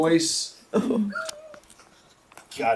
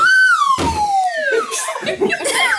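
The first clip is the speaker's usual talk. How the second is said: loud laugh; 3 dB louder than usual. Real, not acted. Slingshot moment cracks them up.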